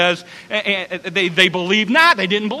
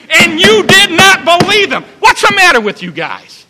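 loud, higher scolding rebuke; 5 semitones higher, 8 dB louder than usual. Acted. Jesus scolding the eleven for their unbelief, angry shout. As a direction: take them to task, building to a shout. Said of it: "he took them to task".

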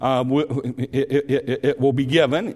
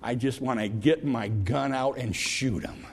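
quiet angry grumble; 3 semitones lower, 6 dB quieter than usual. Retold or imitated. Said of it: "He says".

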